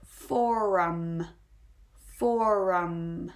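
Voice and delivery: forum drawn out with a long R in the middle; slow pronunciation demo. Shown as a demonstration. Teacher modelling a two-syllable word, slow clear forum. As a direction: say forum slowly and firmly, holding the R. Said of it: "Let's do one more two-syllable word".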